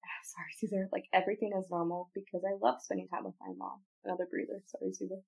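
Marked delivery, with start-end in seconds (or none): none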